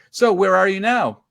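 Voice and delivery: loud, high imitated host question; radio host hail. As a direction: project it to a reporter far away. Imitates DJ hailing him on air.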